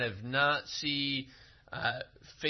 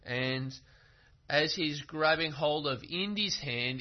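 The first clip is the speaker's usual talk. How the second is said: ordinary talk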